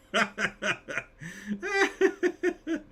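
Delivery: long high-pitched laugh. Real, not acted. Streamer reacting to a chat comment, high laughter. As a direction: laugh out at the joke.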